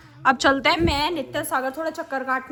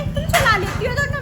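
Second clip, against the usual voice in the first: quoting aunt; 9 semitones higher than usual. Retold or imitated. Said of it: "Sachin चचेरी कह रही".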